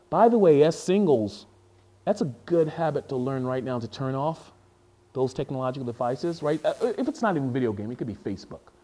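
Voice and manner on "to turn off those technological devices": normal talking voice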